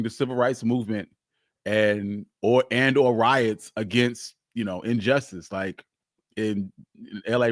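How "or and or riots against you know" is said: usual speaking voice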